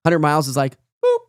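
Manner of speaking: ordinary delivery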